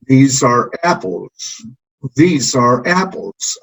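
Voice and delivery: "These are apples" slow, each vowel held long as the pitch falls; patient slow demo for repetition; stretched vowels. Shown as a demonstration. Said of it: "prolongar el sonido de la vocal".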